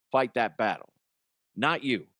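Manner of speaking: ordinary talk, nothing marked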